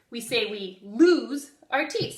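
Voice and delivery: normal talking voice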